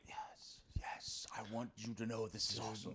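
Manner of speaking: hushed whisper, barely voiced; whispered aside. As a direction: whisper so she can't hear. Secretly praising niece.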